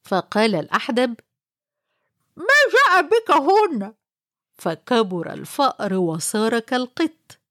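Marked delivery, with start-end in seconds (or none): 2.4-3.9 startled hunchback. Acted. Startled hunchback questions the mouse.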